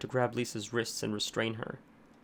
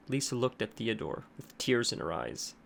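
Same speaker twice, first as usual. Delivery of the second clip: plain reading voice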